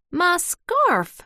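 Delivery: high, loud excited cry; escalating excitement. A narrator's reading. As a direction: squeal with excitement at a lost treasure. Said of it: "Flugie's voice escalated with excitement".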